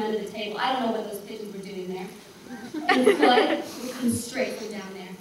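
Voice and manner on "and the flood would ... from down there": put-on an old woman carried away by her dream, getting louder; mounting excitement. Dream flood builds to climax.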